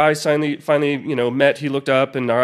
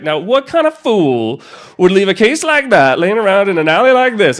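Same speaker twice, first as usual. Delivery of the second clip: louder, scolding like a stern elder; mock lecture; 5 semitones higher, 6 dB louder than usual. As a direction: wag a finger at the careless kid. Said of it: "he was like, lectured me".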